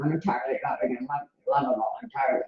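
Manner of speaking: grinding it out against yourself; forced affirmation. Shown as a demonstration. Coach demonstrating the wrong way, rushed and forced. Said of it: "not in a way that you're forcing them".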